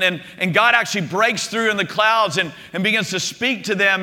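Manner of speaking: ordinary talk, nothing marked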